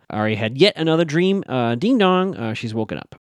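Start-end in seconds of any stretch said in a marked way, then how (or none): 1.7-2.4 singsong two-note ding dong; doorbell imitation. Recapper imitating a doorbell waking the dreamer, singsong. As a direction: chime it higher like a doorbell.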